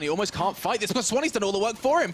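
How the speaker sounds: high, urgent running commentary; excited play-by-play. Real, not acted. Caster calls a race against the defuse timer, excited. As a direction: build tension as time runs out. Live call of round's climax.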